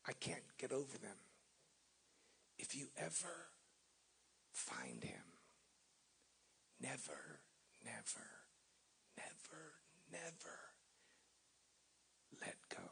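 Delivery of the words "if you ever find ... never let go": near-whispered, each never fading softer; whispered plea. Retold or imitated. Preacher repeating the old man's words to the church, whispered. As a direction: breathe the words like a sacred charge. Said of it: "Those words are daunting".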